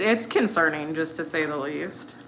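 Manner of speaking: ordinary talk, nothing marked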